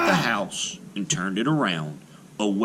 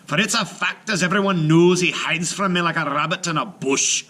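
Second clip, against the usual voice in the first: raised boastful giant voice; giant, mocking; 8 semitones higher, 4 dB louder than usual. Giant mocking Finn to Una, boastful. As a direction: sneer at Finn as a coward.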